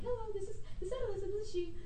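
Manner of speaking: mock-formal "this is she"; polite phone voice. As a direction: imitate answering a job call politely. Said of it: "I'm like".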